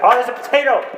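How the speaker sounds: loud excited shout of discovery. Real, not acted. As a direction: burst out, thrilled.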